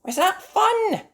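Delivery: very high, slow, cheery question; Mummy Mouse. Acted. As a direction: ask the kids brightly.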